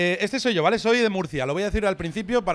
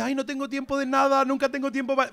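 high-pitched mock fretting; put-on harried whine, pitched up; overwhelmed; 5 semitones higher than usual. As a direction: imitate a stressed-out person complaining. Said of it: "esta gente agobiada".

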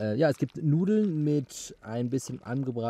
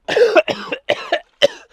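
high, strained coughing; high coughing fit; 6 dB louder than usual. Real, not acted. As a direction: cough hard, breathless. After cold plunge. (hohes, angestrengtes Husten)

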